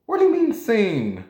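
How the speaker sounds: high-pitched startled question; mock disbelief. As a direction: act out being caught off guard. Acts out startled reaction to read receipt.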